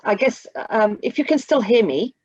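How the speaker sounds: usual speaking voice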